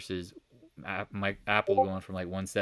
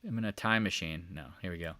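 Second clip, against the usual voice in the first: ordinary talk, nothing marked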